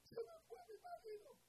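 very high-pitched mock wail; hammed-up crying; imitated weeping wife. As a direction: whine it out like a tearful, abandoned wife. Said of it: "vino a llorar".

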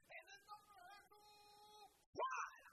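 rising to a loud high cry on fuera; casting out shout. Retold or imitated. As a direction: hurl the command at the demon. Pastor casts out the demon.

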